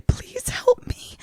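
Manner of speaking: slow, high imitation whisper for help; whispered plea. Grace's voice over someone struggling through snow, whispered plea. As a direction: whisper it like an eerie trailer voice.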